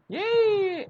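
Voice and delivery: high squeal of joy; cheer. Retold or imitated. Happy client cheering.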